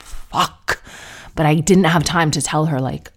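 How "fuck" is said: whispered curse. Retold or imitated. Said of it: "My mom was like".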